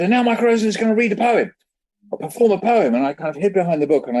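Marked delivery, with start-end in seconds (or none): 0.0-2.9 high, fast, eager announcing; excited announcement. Retold or imitated. Teacher introducing the shy poet to the school, high and eager. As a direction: sell it to the crowd.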